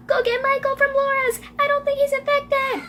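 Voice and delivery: urgent, high-pitched shout held on one note; high yelling. Acted. Emily urges Mom to get Michael, high yell.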